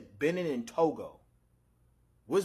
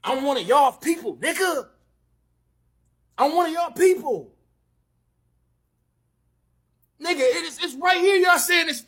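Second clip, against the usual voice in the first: loud, higher indignant yelling; indignant shout; 6 semitones higher, 8 dB louder than usual. Real, not acted. Man shouting at Nigerians to accept him, indignant yell. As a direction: shout it at them, fed up.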